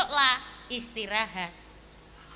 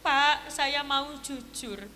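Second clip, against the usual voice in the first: softer and slow, heavy with regret; sad, slow confession; 2 semitones lower, 4 dB quieter, much slower than usual. Acted. Thug confesses to the father, slow and sad. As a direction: confess it slowly, weighed down. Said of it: "Sing premane langsung sedih".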